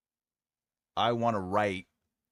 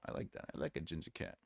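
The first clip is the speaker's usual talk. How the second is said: ordinary delivery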